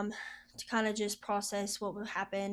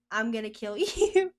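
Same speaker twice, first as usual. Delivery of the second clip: mock threat, laughing it out, a bit louder; joking threat through laughter; 7 dB louder than usual. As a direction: play it as a teasing joke, breaking into a laugh. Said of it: "she makes a joke".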